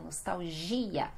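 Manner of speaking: "nostalgia" as nos-tau-ZHI-a, low and soft; Portuguese pronunciation. Shown as a demonstration. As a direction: stress GI in "nostalgia", L like U. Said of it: "esse G é o mais forte".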